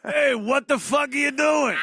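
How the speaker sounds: shouted, laughing mobster; mock mobster yell. Comic imitating a mobster shaking down a landscaper, loud and laughing. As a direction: bark it like a hothead gangster. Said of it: "Hey, it's always so nice".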